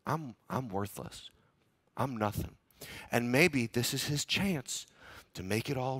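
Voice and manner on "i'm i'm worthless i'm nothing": self-loathing. Retold or imitated. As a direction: sink into it, barely saying it. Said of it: "reasons to say".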